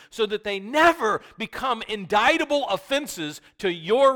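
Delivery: loud, high gospel proclamation; a pastor pressing the gospel on his congregation; full-force preaching. Acted. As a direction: preach it out with fire.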